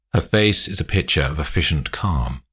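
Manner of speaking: plain reading voice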